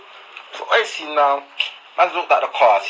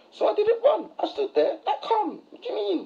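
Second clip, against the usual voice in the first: high amused voice; 6 dB quieter than usual. Real, not acted. Retelling staying calm, amused.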